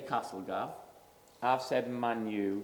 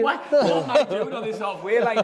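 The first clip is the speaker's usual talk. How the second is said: higher, louder, half-laughing bewilderment; imitating a confused defender; 7 semitones higher, 10 dB louder than usual. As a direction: playfully voice a defender stranded on halfway. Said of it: "they must have been thinking".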